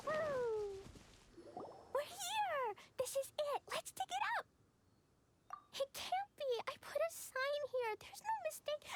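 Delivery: put-on high, eager child's chatter; excited. Little girl arrives at her treasure spot, high and excited. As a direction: bounce with treasure-hunt glee.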